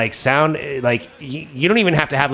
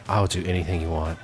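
smitten, hushed; 6 semitones lower, 8 dB quieter than usual. Retold or imitated. Smitten teen answering the woman.

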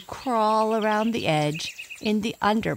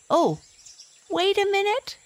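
startled plea. A narrator's reading. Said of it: "said my father".

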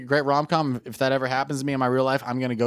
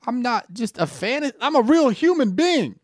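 indignant; 10 semitones higher, slower than usual. Retold or imitated. Indignant kid voice.

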